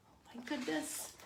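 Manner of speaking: ordinary delivery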